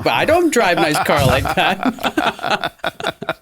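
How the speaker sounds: slow, laughing Vietnamese accent imitation. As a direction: drawl it slowly in her Vietnamese accent, half laughing. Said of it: "she's from Vietnam".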